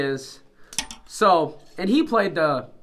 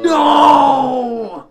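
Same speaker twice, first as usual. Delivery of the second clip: loud high crying "No!"; hammed-up anguished wail; mock wail; 8 semitones higher, 12 dB louder than usual. Mock wailing no.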